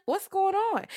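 innocent high what's going on; high confused voice. Retold or imitated. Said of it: "he's like".

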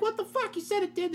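high, indignant what the fuck; outraged disbelief. Retold or imitated. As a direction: shoot way up in outrage. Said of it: "I'm like".

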